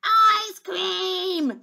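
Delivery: high, demanding little princess shout; bossy, high-pitched demand. Acted. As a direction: shout it like a spoilt child. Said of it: "she shouted".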